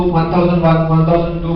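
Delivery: steady one-second count in English, a bit soft; paced English count. Shown as a demonstration. Teacher contrasting the quick count with the one-second count. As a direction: tick off each second evenly. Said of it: "বললে এক second".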